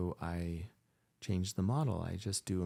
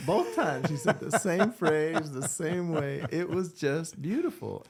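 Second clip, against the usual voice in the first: higher, louder, delighted through laughter; laughing fondly; 9 semitones higher, 7 dB louder than usual. Real, not acted. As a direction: gush about it with a laugh. Laughing fond recollection.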